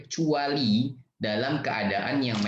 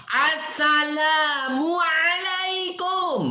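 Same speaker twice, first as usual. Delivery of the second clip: greeting shouted high and slow; loud drawn-out greeting; 4 dB louder, much slower than usual. Retold or imitated. Teacher's third try so the stranger finally hears, loud slow call. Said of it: "Yang ketiga Kak Yogi bilang".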